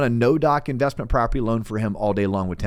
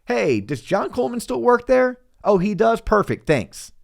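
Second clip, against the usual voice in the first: a much higher, chirpy voice on a routine call; breezy phone check; 9 semitones higher than usual. Retold or imitated. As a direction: play the lender cheerfully ringing the employer. Acting the verification phone call.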